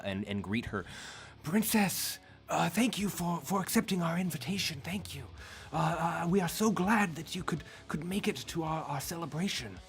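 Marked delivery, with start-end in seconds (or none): 1.4-9.8 high, eager host voice; a flustered, fawning greeter; eager elder. Acted.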